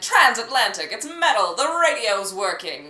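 put-on high, loud old radio proclamation; mock transatlantic announcer. Playing up the name transatlantic to a co-host, loud and declamatory. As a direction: declaim it like a vintage newsreel. Mimics what the accent's name evokes.

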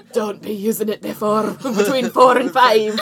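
loud, slow Scottish impression; hammed-up Scottish, drawn out; Scottish accent. Imitating a cartoon groundskeeper for friends, Scottish accent. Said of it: "that's a good Scottish accent".

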